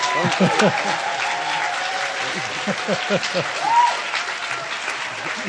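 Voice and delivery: airy, rising laugh with no voice; a delighted breathless laugh; breathy laugh. Real, not acted. Host laughs at praise.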